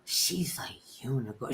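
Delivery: soft, low whispered wonder; awed whisper. Retold or imitated. Twin marvelling at a celebrity, whispering. Repeats twin's awed line.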